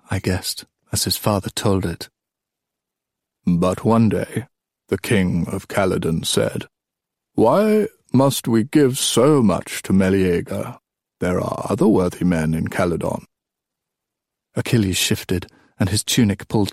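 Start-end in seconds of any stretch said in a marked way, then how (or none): 3.4-13.3 put-on old king storytelling.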